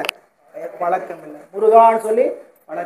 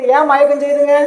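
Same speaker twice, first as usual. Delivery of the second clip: ordinary talk, nothing marked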